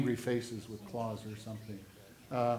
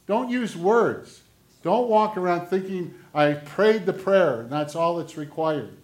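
loud, raised-pitch scripture proclamation; forceful preaching; 4 semitones higher, 9 dB louder than usual. Acted. Preacher declaring the verse to the congregation, loud and forceful. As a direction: preach it out with heavy emphasis.

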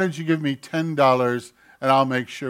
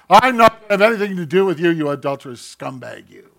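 harsh rejection; 2 semitones higher, 8 dB louder than usual. Acted. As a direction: throw the brother out with disgust. Acted harsh rejection of a brother.

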